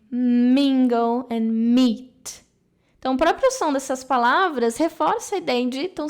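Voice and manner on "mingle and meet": Mingle and meet said slowly, each M held with lips closed; stress the M sounds. Shown as a demonstration. Teacher demonstrating lips meeting on the M, slow and emphatic. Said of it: "se encontram em um só pra fazer esse som do M".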